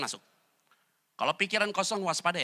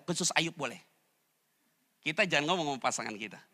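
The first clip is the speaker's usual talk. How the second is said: normal talking voice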